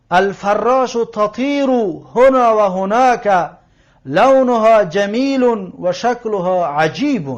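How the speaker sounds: slow, deliberate teacher's recitation; Arabic text read-aloud. Shown as a demonstration. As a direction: read it out slowly, phrase by phrase. Reads the Arabic lesson text. (روخوانی آهسته و شمرده)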